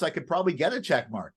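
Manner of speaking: normal talking voice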